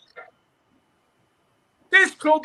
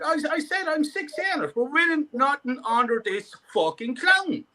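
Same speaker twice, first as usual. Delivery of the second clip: ordinary delivery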